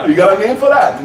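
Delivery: local cop. Retold or imitated. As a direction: play the curious local cop. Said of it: "local cop".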